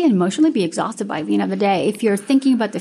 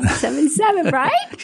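higher, breaking into a laugh; amused laughing; 5 semitones higher than usual. Real, not acted. Guest joking with host about forgiving seven times, amused laughing. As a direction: laugh through the words.

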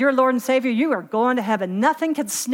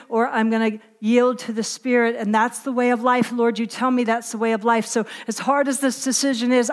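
ordinary delivery

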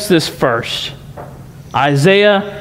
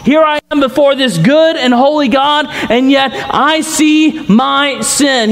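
preaching at full force; 9 semitones higher than usual. Acted. Preaching Isaiah's confession.

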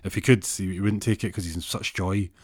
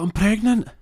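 high, loud announcement of big news; raised, emphatic reveal; acted big announcement; 14 semitones higher, 8 dB louder than usual. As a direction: blurt out the news, pitched way up. Acted announcement of pregnancy to husband.

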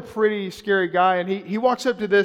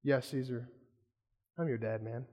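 much lower and softer, tender; tender reply; 6 semitones lower, 10 dB quieter than usual. Retold or imitated. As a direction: gently reassure the young man. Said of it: "And Greg says".

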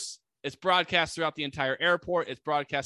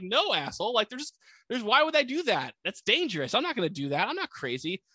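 higher-pitched, indignant retold protest; mock indignant refusal; 6 semitones higher than usual. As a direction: push back like an incredulous pilot. Pilot refuses the crazy request.

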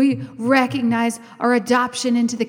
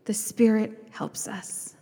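slow, breathy whispered line; soft slow whisper; 4 semitones lower, 7 dB quieter, slower than usual. Real, not acted. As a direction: hush it as if sharing a secret. Hushed after long pause.

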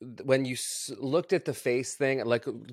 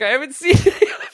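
laughing; 14 dB louder than usual. Retold or imitated. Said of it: "You're like".

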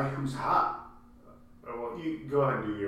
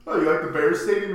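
lightly acted loud, higher-pitched question; Hoffman; 7 semitones higher, 9 dB louder than usual. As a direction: put a little mock surprise on it, raised voice. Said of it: "Hoffman comes out and he's like".

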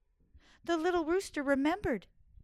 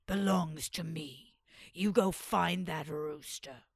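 low commanding sultan; 7 semitones lower, slower than usual. Acted. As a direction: lower and slow, giving orders. Sultan orders servants.

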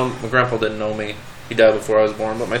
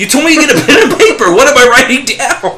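high, loud and fast, laughing through it; laughing exasperation; 13 semitones higher, 7 dB louder than usual. Retold or imitated. Telling the scam caller off, laughing in disbelief. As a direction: blurt it out laughing, fed up. Retelling own exasperated reply.

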